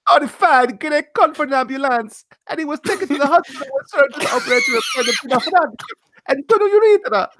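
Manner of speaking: high, fast cartoon plumber shouting the news; hammed-up video game hero voice; Luigi voice. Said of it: "Now I'm gonna read this as Luigi".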